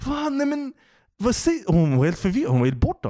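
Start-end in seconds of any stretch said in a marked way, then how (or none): 0.1-1.6 high falsetto bewildered whine; puzzled drunk woman asking who pays, in a squeaky high voice; confused drunk woman. Retold or imitated. Mimicking the confused drunk passenger, high falsetto. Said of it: "Och hon bara".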